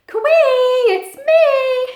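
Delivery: high, loud cheerful call. Acted. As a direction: call out to far-off friends. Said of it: "he called to his friends".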